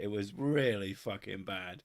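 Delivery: ordinary delivery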